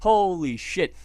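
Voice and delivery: mock-impressed praise; sarcastic awe. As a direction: gush in fake admiration.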